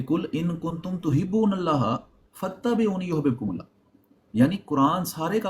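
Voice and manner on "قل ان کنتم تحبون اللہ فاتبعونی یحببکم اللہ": slow chanted recitation; Quran recitation. Real, not acted. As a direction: recite the verse slowly. Quran verse recited slowly.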